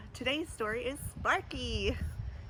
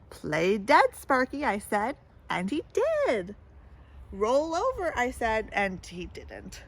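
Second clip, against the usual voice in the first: storybook read-aloud voice; lively picture-book reading; story narration; 4 dB louder than usual. A narrator's reading. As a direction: tell it to a child at bedtime.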